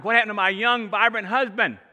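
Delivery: loud, high mock alarm from the wife; retold wife, shocked and loud; wife's shocked cry. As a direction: play the wife gasping at a worn-out husband. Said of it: "my wife was like".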